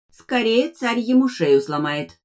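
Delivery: high, slow creaky voice; drawn-out high creak; creaky translator device. A narrator's reading. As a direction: squeak it out like a talking gadget. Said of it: "скрипнул переводчик Ариэль".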